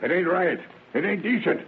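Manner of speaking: a stern father's moral outrage; indignant father. Acted. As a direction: scold him, voice climbing. Father scolding son over divorcee.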